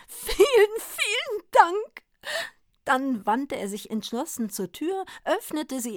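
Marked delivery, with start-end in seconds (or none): 0.1-2.0 overflowing grateful sob; sobbing with joy. A narrator's reading. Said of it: "schluchzte er begeistert".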